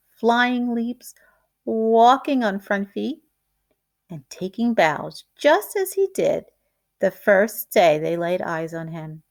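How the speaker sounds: ordinary delivery, nothing marked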